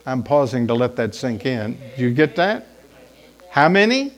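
ordinary delivery

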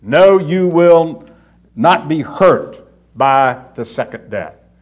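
slow, with "No" and "not" weighted; emphatic. Shown as a demonstration. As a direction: spell out each word of the promise slowly. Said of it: "the most emphatic statement you can make".

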